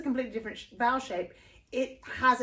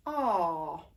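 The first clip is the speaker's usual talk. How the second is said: posh English ah; 5 semitones lower than usual. Shown as a demonstration. Voice teacher repeating posh English ah. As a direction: let the ah fall away gently, posh English. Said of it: "It's a very kind of posh English sound".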